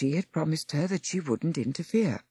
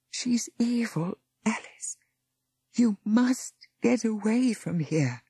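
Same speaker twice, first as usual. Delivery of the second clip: weak whisper; 7 semitones higher than usual. Acted. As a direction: warn her with your last breath. Dying Patricia whispers warning.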